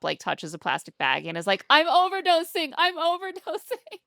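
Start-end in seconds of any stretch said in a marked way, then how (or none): 1.7-4.0 high-pitched, hammed-up panicked cry; mock panic. Mimicking a cop in a fentanyl panic video, mock panic. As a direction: imitate someone wildly overreacting in terror. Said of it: "one of those fentanyl panic videos".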